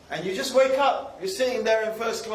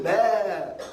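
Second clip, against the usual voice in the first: slow, drawn-out "baa"; mock sheep noise; sheep bleat. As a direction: bleat like one of the flock. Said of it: "we're just sheep".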